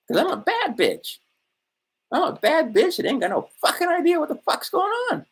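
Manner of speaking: very high, hammed-up sassy woman's voice; mock a boastful woman who pays her own bills, high and sassy; mock bad bitch. Mocks a self-styled bad bitch.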